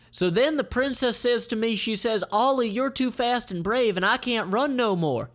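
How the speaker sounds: high, hammed-up braggart; silly soldier's report. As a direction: read a boastful tale. Reading silly report aloud.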